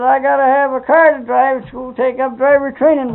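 high, loud mock insistence; retold insistent daughter. Girl arguing with her father over a car, mock insistence.

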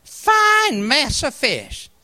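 slow, drawn-out Appalachian mountain dialect. Retold or imitated. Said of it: "in my mountain dialect".

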